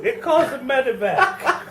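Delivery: put-on high, slow, laughing old voice; young person faking old. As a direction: wheeze it out with a laugh. Beaten thug answering Peggy.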